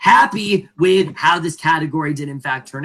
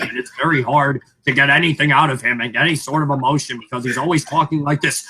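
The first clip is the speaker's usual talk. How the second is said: ordinary delivery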